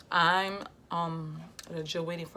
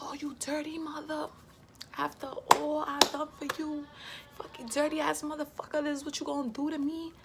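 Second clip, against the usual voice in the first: furious cursing; 11 semitones higher than usual. Retold or imitated. Cursing out her baby father in the kitchen, furious and high. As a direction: spit the insults out in a rage. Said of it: "I was just so pissed off that I'm just there like".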